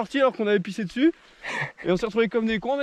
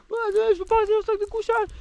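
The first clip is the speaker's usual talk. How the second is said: much higher whiny dog voice; 11 semitones higher than usual. Retold or imitated. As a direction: voice the dog complaining. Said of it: "j'étais là en mode".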